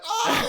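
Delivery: high, loud imitated crowd whoop; mock roar of amazement; crowd going crazy. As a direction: cry out like a whole room erupting. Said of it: "the whole room went crazy".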